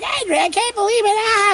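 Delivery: very high, far put-on little girl shriek; disgusted girl. Girl rejecting Henry's valentine, high disgusted shriek. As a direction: shriek it like a grossed-out child.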